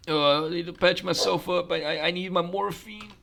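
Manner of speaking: high, strained put-on voice; whimpering wounded character. Injured player needing morphine, comically whimpering. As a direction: whine it like a hurt patient, softening at the end.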